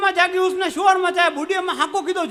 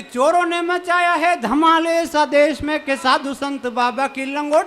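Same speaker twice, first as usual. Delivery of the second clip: poem recital; 4 semitones lower than usual. Acted. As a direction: declaim the verse gravely. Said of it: "चार पंक्तियां पढ़ता हूँ".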